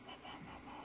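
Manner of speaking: barely breathed, unvoiced; a lost whisper for papa and mama; whispered plea. Whispered call to parents.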